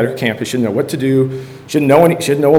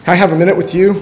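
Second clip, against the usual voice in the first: put-on a hesitant kid interrupting practice; lightly voiced boy asking for a minute; kid asking the coach; 5 semitones higher than usual.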